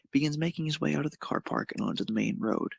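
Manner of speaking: usual speaking voice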